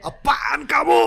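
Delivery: loud, slow scolding shout at the newsreader; hammed-up outraged yell; mock angry shout. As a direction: bellow it like an annoyed viewer. Viewer scolding smiling newsreader.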